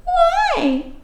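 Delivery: put-on high, wondering little girl asking why; small child voice. Cindy Lou asking the fake Santa, small and high. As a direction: lift it up into a tiny child's question.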